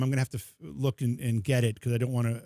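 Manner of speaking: ordinary talk, nothing marked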